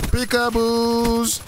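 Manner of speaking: high, slow sing-song call; drawn-out playful hide-and-seek call. Pretend. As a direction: call it out high, stretching each syllable. Playful calling out in game.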